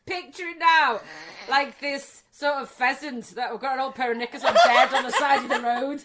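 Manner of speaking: loud laughing description; cracking up while painting the picture; laughing loudly as she describes the scene. Real, not acted.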